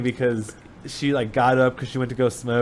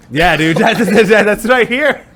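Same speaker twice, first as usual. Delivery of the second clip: words breaking up with laughter, higher and louder; laughing; 8 semitones higher, 6 dB louder, slower than usual. Real, not acted. Friend laughing at the joke about Jake, words breaking into laughter. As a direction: laugh through the line.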